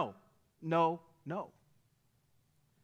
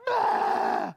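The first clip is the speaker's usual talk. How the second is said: a loud burst of despair, mock; exasperated shout; 7 dB louder than usual. Preacher acting out a congregant at rock bottom, loud fed-up "Ah". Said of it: "We're like".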